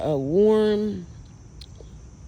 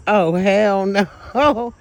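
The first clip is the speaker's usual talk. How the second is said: much louder, drawn out slow; loud refusal; 3 semitones higher, 9 dB louder, much slower than usual. Real, not acted. Watching a light blink, loudly refusing and slowed down.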